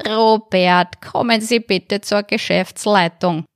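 a manager paging an employee over the loudspeaker; store loudspeaker announcement. A narrator's reading. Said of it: "durch eine schnorrige Stimme unterbrochen".

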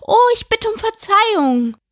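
high-pitched, dismayed apology; alarmed apology. A narrator's reading. Alice catching her blunder before the mouse, alarmed apology. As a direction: gasp out "oh" high, then apologise.

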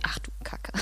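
hushed, voiceless aside to oneself; muttered dismay. Retold or imitated. Guest recalls first arriving in Berlin Wedding, muttered dismay. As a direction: drop to a near-whisper, as if thinking it.

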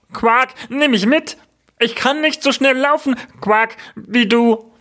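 very high, put-on frog voice with quacks; frog calling out. Frog left behind by the princess, high calling voice. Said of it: "rief der Frosch".

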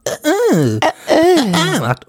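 high strained grunts; imitated grunting noise. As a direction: copy the odd noise the woman makes. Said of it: "wo eine Dame immer ... macht".

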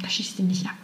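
quiet, half-whispered encouragement; soft hushed advice. Retold or imitated. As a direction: hush it like a secret tip. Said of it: "damit die Person dann sagt". (leise geflüstert)

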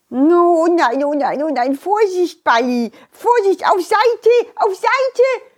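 rising panicked cries; panicked warning. Acted. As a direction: shout a frantic warning. Warning as ball rolls.

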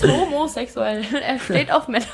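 talking through real laughter; amused laugh breaking into the line. Real, not acted. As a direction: giggle through the words. Laughing after own slip.

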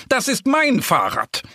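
high, indignant protest; calling out. A narrator's reading. Boar claims his bicycle, calling out indignantly. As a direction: claim it on a high, insistent pitch. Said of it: "ruft es lautstark".

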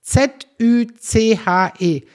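slow, loud, letter by letter; each letter punched separately; emphatic spelling. Shown as a demonstration. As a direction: spell it out slowly and pointedly. Spelling out the wrong pronunciation.

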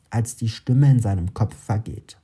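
ordinary delivery, nothing marked

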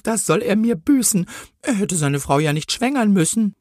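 high-pitched outpouring of rhymed confession; remorseful policewoman. A narrator's reading. Policewoman confesses her radar traps, high and full of remorse. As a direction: let the confession burst out high, in rhythm. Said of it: "die Politesse war so voller Reue".

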